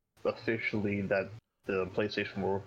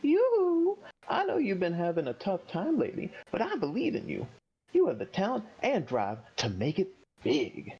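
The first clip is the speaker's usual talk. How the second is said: hype man voice; 9 semitones higher than usual. Acted. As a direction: gush like a flashy hype man. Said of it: "the Hype Man".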